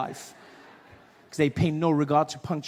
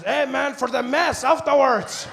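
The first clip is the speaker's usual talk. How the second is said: put-on higher, slow Mexican-accented explanation; 6 semitones higher, 5 dB louder, slower than usual. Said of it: "He says".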